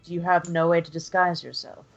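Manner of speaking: ordinary talk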